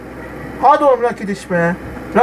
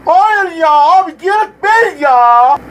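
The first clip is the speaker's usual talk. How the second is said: slow lament; 6 semitones higher, 4 dB louder, slower than usual. Real, not acted. Gamer laments miss.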